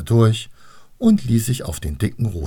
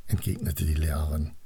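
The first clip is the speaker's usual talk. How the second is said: usual speaking voice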